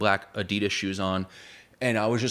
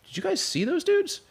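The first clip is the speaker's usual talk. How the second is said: much higher, startled question; mock astonished asking the friends; bewildered question. Narrator asks friends about vanished strangers, high and incredulous. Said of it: "I'm like".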